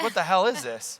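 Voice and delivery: a touch of mock frustrated disbelief; frustrated dad. Dad losing at Wii Tennis to Travis, mock frustrated disbelief. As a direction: raise the pitch in exasperated disbelief.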